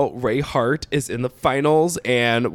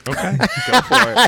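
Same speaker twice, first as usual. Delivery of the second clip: laughing; 9 semitones higher than usual. Real, not acted. Laughing at offer.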